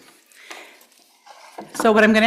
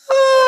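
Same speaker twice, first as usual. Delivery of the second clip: high, loud ah carried on the exhale; open ah exhale; 7 dB louder than usual. Shown as a demonstration. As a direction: pour your energy out on an open ah. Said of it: "Let's do it again".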